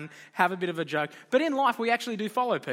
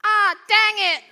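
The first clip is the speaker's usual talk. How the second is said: put-on "Ah, dang it" in a much higher, louder, slow little-kid voice; toddler copying dad; 10 dB louder, slower than usual. Two-year-old falls over and parrots dad, high and slow.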